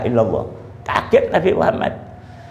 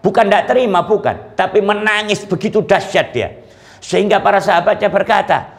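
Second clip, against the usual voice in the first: ordinary talk, nothing marked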